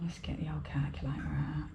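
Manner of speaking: whispered aside; hushed, to herself; quiet whisper while reaching for something. Real, not acted. Fetching the calculator.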